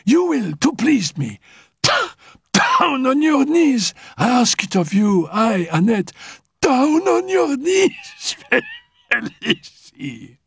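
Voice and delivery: high, laughing taunt; mocking command. Annette taunts Félicie to kneel, high and laughing. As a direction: tease her down to her knees.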